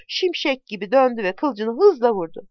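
ordinary delivery, nothing marked

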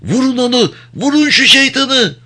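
very high-pitched panicked command; high, thin voice of fear and exhaustion; shrill girlish shriek. A narrator's reading. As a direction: squeal it out like a frightened little girl. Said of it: "kız çocukları gibi tiz bir ses çıkarıyordu".

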